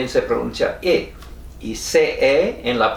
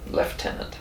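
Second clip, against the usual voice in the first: British lieutenant; 9 dB quieter than usual. Shown as a demonstration. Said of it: "así que está bien".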